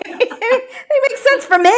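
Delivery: laughing through the words; giggling as she defends herself. Real, not acted. To her friends, laughing as she insists.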